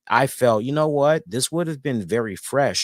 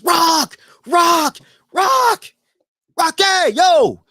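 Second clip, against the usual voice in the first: high, loud repeated shouts; excited fan hollering; calling out; 10 dB louder, much slower than usual. Retold or imitated. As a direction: yell to get a star's attention. Acting out calling to a celebrity.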